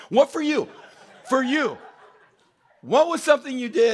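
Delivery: usual speaking voice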